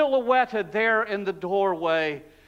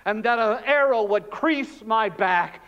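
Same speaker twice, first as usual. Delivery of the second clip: normal talking voice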